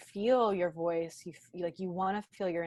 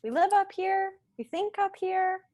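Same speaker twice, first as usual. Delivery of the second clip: much higher, light heady voice; high pitched; 13 semitones higher, 7 dB louder than usual. Shown as a demonstration. Showing the listeners the high ungrounded voice. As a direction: lift it up into the head. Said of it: "It's very high pitched".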